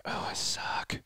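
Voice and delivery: breathy whispered "I suck" under the breath; whispered self-mockery. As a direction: mutter it to yourself like a defeated beginner. Mocking self-defeat with new bow.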